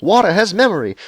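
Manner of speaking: high, loud shouted rapture; mock ecstatic yelling. Running through the streets yelling at everyone, mock ecstatic yell. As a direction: yell it like a madman who's just been converted. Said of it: "I'll run through the streets yelling".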